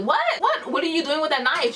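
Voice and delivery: high, quick disbelieving repeats; retold shock, pitch jumping up; incredulous questioning. Teller confronts Katrina about the knife, incredulous.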